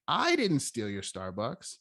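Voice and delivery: slow line with a stretched, emphatic "I"; stress on I. Shown as a demonstration. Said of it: "Now, let's emphasize this".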